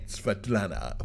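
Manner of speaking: lightly voiced, soft low seductive Russian woman; sexy woman's voice. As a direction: imitate her sexy voice, quiet and low.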